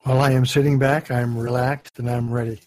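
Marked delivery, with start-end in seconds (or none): none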